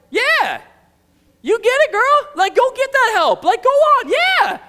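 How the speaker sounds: very high, loud egging-on; rowdy cheering. Acted. Reader urging Martha to get help, excited cheering. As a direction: hype her up, sassy and shouting.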